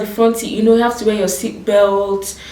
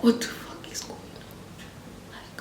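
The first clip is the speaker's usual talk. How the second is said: slow, voiceless whisper; 15 dB quieter, slower than usual. Retold or imitated. To herself in the cab, a slow shocked whisper. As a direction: whisper it in disbelief. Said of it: "I was like".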